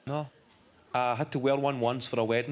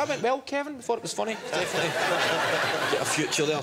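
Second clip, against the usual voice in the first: lightly acted, high-pitched awkward praise; relatives' awkward encouragement; 6 semitones higher, faster than usual. Family consoles the young comic on the drive home, high-pitched encouragement. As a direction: put on a higher voice for polite family reassurance.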